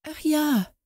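high, caught-out little ach ja; flustered. A narrator's reading. Wife caught out by the aunt, flustered. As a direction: stumble over it, caught. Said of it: "sagte die Hausfrau ganz verwirrt".

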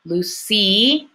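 Lucy with a stretched final EE; phonics-style Lucy, long second syllable; long E ending. Shown as a demonstration. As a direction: hold the EE at the end of Lucy. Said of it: "the E sound".

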